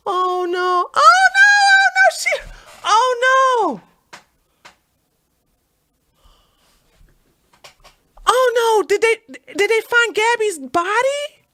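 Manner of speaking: high, loud alarmed cries of oh no; real panic at bad news. Real, not acted. Vlogger reads the trending news and cries out, high and loud in alarm. Reading shocking news live.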